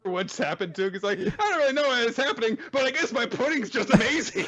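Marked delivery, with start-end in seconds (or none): 1.2-4.5 high-pitched cheerful little-creature voice; clueless proud character. Retold or imitated. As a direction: marvel in a put-on high voice. Clueless Naputaku revels in praise.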